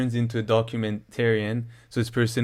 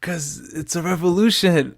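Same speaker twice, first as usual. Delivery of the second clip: higher, lightly mocking girl's voice; mock rioter girl; 6 semitones higher than usual. Quoting the Capitol girl from the viral clip, mock earnest. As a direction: play her answer as naive and earnest, pitched up. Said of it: "then she's like".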